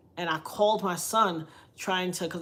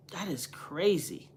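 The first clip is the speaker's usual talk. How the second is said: whisper; 4 dB quieter, slower than usual. Real, not acted. Whispered disbelief.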